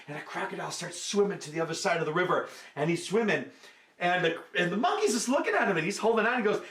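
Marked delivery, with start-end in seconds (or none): none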